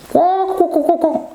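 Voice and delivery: high-pitched squawking hen; chicken clucking. Pretend. Teacher acting out the animal, chicken clucking.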